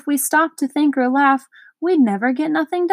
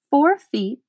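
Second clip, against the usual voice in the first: ordinary delivery, nothing marked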